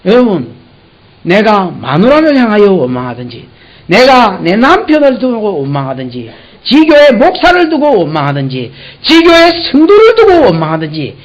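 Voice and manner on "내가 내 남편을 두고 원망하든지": ordinary delivery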